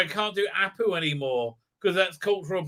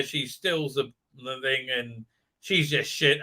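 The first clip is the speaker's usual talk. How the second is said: ordinary delivery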